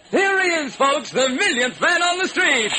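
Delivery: high, loud showman host; radio announcer. Acted. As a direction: hype the crowd.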